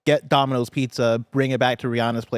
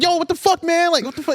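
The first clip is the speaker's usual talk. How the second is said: pitched-up retold yell, much higher; high mock yell. Yelling at the pizza guy, high mock yell. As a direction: shout it way up high. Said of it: "in that moment".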